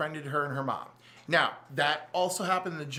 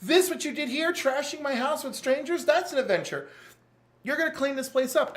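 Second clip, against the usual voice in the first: higher, louder mock scolding of a reckless brother; scolding Wonder Woman; 10 semitones higher, 4 dB louder than usual. Wonder Woman scolding Jason for trashing her house, higher and louder. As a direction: read him the riot act, pitched way up. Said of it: "She goes".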